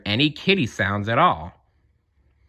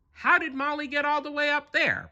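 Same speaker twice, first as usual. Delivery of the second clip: much higher, softer puzzled cartoon dog voice; worried dog voice staring up at a tree; Pluto wondering; 5 dB quieter than usual. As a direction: wonder aloud, high and hushed. Pluto wondering about Molly.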